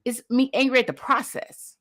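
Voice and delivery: normal talking voice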